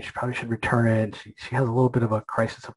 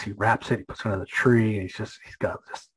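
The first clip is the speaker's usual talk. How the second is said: normal talking voice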